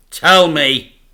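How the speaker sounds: loud, high demand. A narrator's reading. Boy demands the secret, loud and firm. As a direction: insist on it. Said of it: "demanded the boy".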